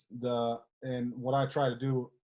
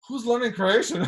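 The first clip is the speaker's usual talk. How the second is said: surprised; 8 semitones higher than usual. Retold or imitated. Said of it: "And I remember thinking".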